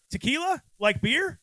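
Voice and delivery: high, incredulous Tequila? like beer?; hammed-up confusion; mock baffled question. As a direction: pitch way up in disbelief. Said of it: "they're like".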